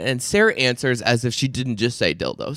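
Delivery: normal talking voice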